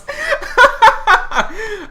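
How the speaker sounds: loud high peal of laughter; real laughter. Real, not acted. Cracks up at the joke.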